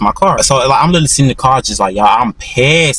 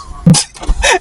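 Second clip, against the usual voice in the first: breathy laugh. Real, not acted. Showing how he rolled down.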